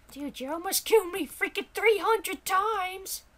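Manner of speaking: high-pitched complaining character; indignant high little squawk; outraged sidekick voice. Acted. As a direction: whine at the player in a high voice. Character blames the player.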